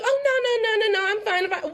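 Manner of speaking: high, dismissive fake-fine voice; high mock brush-off. Imitating a people pleaser insisting she's fine, high and dismissive. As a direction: brush it off in a high pretend-cheery voice. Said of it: "if you find yourself going".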